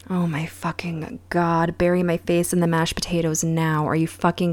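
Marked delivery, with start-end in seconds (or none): 0.1-1.8 breathy whispered disbelief, slowed right down; disbelieving whisper. Real, not acted. Vlogger cringing at the dinner scene, whispered disbelief. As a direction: whisper it like you want to hide under the table.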